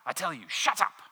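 snapping loudly at the lizard; a man snarling at the lizard on his shoulder, loud and impatient. A narrator's reading. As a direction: bark it out, fed up. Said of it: "with a snarl of impatience".

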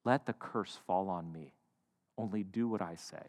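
low, quiet and deliberate; chilling. Acted. Mom takes the curse on herself for her son, chilling. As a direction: drop low and soft, with quiet menace. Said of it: "says something incredibly chilling".

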